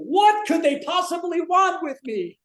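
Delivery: a high, loud, slowed bellow of panic; furious outburst. Retold or imitated. Ralph slamming the table at dinner, a high bellowing outburst. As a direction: Ralph blows up and roars the question.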